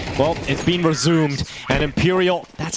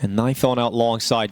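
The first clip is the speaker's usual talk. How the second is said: usual speaking voice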